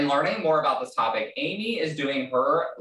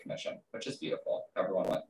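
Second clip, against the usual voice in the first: much softer, nearly whispered; hushed aside; 4 semitones lower, 10 dB quieter, faster than usual. Real, not acted. Host admitting affiliate commission to listeners, hushed aside. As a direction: drop the volume right down, almost to a whisper.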